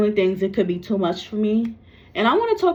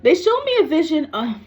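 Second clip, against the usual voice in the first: high, upset burst that breaks off; really angry; 8 semitones higher, 4 dB louder than usual. Real, not acted. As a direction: start high and angry, then stop short. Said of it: "I'm actually really getting angry".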